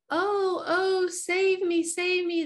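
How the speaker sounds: high, slow, pleading melodrama damsel; damsel in distress. Acted. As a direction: wail it out like a helpless damsel. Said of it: "the damsel in distress".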